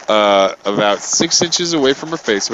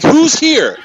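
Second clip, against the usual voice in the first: high, loud demand; exasperated shout; 13 semitones higher, 8 dB louder than usual. Retold or imitated. To the old lady, a high loud demand. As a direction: push the question at her. Repeating the question louder.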